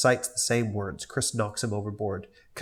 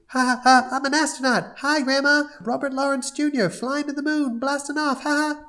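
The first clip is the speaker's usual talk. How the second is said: put-on much higher, excited little boy; high squeaky child voice; hyper kid voice; 3 dB louder than usual. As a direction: bounce it like a kid playing astronaut. Cleveland Junior voiced.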